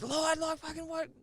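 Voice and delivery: frantic high call; screaming. Retold or imitated. Driver yelling at crew in the back, high panicked call. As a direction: shout it up at the tray, panicked. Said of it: "screaming at the back of the tray".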